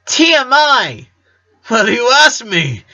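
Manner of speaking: laughing over-the-top protest; mock outrage. A narrator's reading. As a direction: squeal it in embarrassed disgust.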